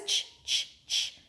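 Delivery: soft unvoiced "ch" puffs; train chugging noise. Shown as a demonstration. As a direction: imitate the train softly with "ch". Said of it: "Can you make the ch".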